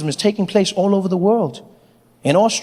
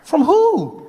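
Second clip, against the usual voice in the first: incredulous; 7 semitones higher than usual. Real, not acted. Mocking Brexit voters who want Britain back, incredulous. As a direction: demand an answer in disbelief.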